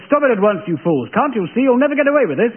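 a hint of a camp old-film hero; lightly hammed-up arch Englishman. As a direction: play it like a prim English gentleman. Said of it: "It was as though I'd said".

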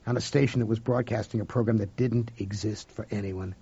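plain reading voice

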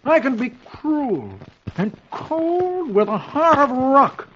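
high, slow, self-important husband; proud boasting. A narrator's reading. Dagwood to himself at the window, proud drawn-out boasting. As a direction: savour each boast, stretching the big words. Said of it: "says to himself proudly".

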